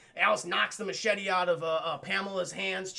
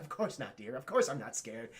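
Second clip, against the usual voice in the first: low soft voice; 8 semitones lower, 5 dB quieter than usual. Retold or imitated. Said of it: "Pamela's like".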